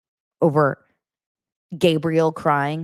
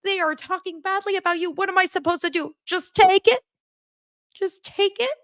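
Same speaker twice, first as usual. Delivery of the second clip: high, indignant mock whine; mocking Cody; 12 semitones higher than usual. Vlogger mimicking Cody defending Robin, high indignant whine. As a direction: whine it as the wronged husband. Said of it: "And he goes".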